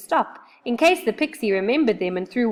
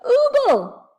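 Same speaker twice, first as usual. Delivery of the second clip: much higher, loud alarmed oobling; frightened cry; 7 dB louder than usual. Acted. Moonface grabs Joe and cries out in fright. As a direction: cry out in alarm through stuck teeth. Moonface panics, mouth full.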